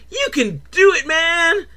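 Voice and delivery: high-pitched cartoon encouragement; talking balloon cheering. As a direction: squeal encouragement as a little balloon. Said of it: "the balloon starts talking to him".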